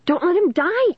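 high-pitched desperate plea; narrated shriek. A narrator's reading. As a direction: plead in a high, panicked cry. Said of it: "Caitlin shrieked".

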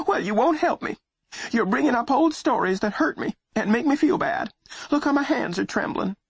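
high, quavering self-pity; trembling voice. Acted. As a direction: whine near tears, shaking. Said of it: "her voice was trembling".

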